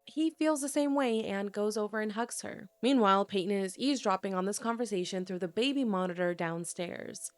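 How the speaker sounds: ordinary delivery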